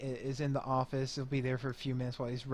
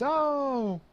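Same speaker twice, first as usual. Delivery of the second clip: long high-pitched "ohh" of relief; crowd's exaggerated relieved "ohhh"; 12 semitones higher, 3 dB louder than usual. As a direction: draw out a relieved oh, pitch soaring. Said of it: "people look relieved".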